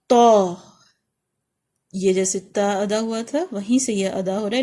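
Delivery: ordinary delivery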